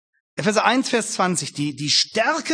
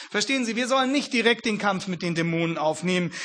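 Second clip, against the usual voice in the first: usual speaking voice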